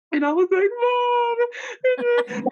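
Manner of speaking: high, loud tearful wail; sobbing to mom on the phone; crying to mom. Retold or imitated. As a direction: wail "Mom" through happy tears. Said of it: "because I was crying".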